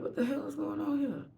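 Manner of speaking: high, baffled "what the hell"; bewildered. Retold or imitated. Confused woman asking herself why she can't stop crying.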